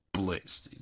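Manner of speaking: normal talking voice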